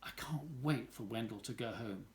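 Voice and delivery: soft, under-the-breath wish; quiet to herself. Acted. Sophie wishing Wendell gone, softly to herself. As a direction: say it softly so only she hears.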